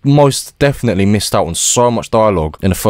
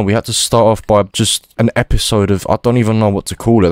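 ordinary talk, nothing marked

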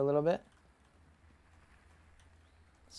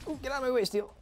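high squeal; 12 semitones higher, faster than usual. Real, not acted. Dodging enemy.

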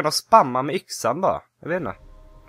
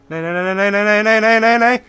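rising from slightly up to high, louder "nej"s; building panic; 8 semitones higher, 7 dB louder than usual. Real, not acted. Gamer in danger again, building panicked no's. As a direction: panic mounting with each no.

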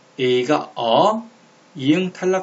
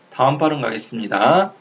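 ordinary delivery

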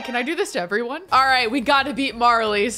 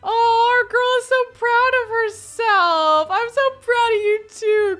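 high, loud, beaming squeals; gushing pride; 12 semitones higher, 6 dB louder than usual. Real, not acted. Player gushing with pride over her sim's success. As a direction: gush like a proud parent.

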